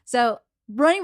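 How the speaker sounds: ordinary delivery, nothing marked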